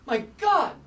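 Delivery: high, loud shocked gasp; shocked exclamation. Acted. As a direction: blurt it out in shock. Anchor reacts to shocking claim.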